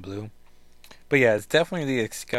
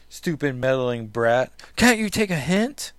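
angry scolding; 2 semitones higher, 5 dB louder than usual. Acted. Rachel to Kitty who won't leave, angry outburst. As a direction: lash out at an unwanted meddler.

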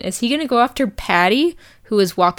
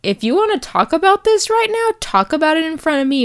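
mock outburst; 8 semitones higher, faster than usual. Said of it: "she's like".

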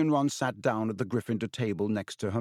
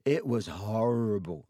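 after a gulp. A narrator's reading. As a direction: recall the horror timidly. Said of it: "he gulped".

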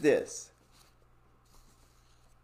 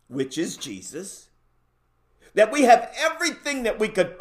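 high, fervent preaching; 5 semitones higher, 4 dB louder than usual. Real, not acted. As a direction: preach it at full force. Preacher builds to full force.

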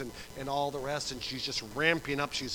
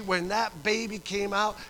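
usual speaking voice

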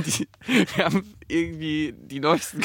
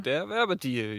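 bright announcer intro, higher pitch; chipper 1950s ad; 4 semitones higher, slower than usual. Acted. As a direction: announce the sponsor like an old radio ad. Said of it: "Und deswegen kommt gleich der Werbedeal".

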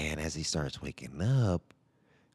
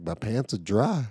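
put-on higher, louder kid protest; kid's defense; 5 semitones higher, 7 dB louder than usual. Son telling parents his pants are dry. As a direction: play the boy proudly proving his point.

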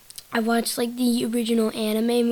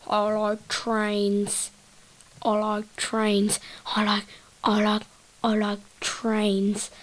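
very slow, dopey droning repetition; I like trains voice; slower than usual. Pretend. Showing another voice, slow dopey train kid. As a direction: drag each word out like a dazed kid. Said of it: "here's another voice".

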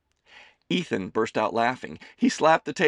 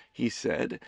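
ordinary delivery

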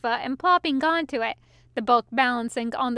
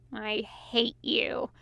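much softer, slow deadpan; 2 semitones lower, 7 dB quieter, slower than usual. A narrator's reading. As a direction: say it flat and quiet, completely unamused. Unamused demon after downing wine.

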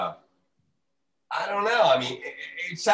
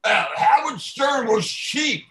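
higher, louder, slowed mock voice; mock self-important author; 6 semitones higher, 3 dB louder than usual. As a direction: sneer like a tell-all author. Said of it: "now he comes out".

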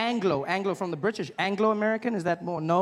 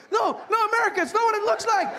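panicked plea; 12 semitones higher, 3 dB louder than usual. Pretend. Panicked sandcastle builder pleads.

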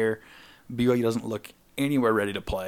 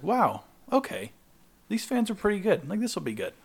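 mock amazed, pitched way up; impressed wow; 10 semitones higher than usual. Wife reacting to friendly fans, impressed and high.

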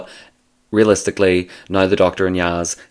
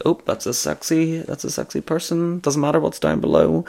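flirty high voice; 9 semitones higher, 5 dB quieter than usual. Retold or imitated. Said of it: "so sometimes I'm just like".

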